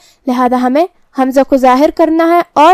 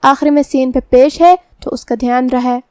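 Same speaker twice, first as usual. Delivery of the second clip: usual speaking voice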